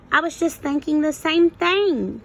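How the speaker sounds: bright eager agreement; Farfalina speaking. Acted. Butterfly answers Marcel, eagerly. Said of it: "said Farfalina".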